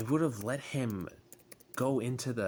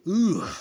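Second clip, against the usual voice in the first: loud, high groan of frustration; loud frustrated groan; 8 semitones higher, 10 dB louder than usual. Real, not acted. Frustrated rant.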